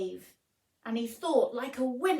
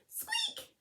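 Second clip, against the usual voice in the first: very high, soft squeaky peep; tiny squeak; 5 dB quieter than usual. Acted. Mouse squeaking mid-plea to the lion, very high and soft.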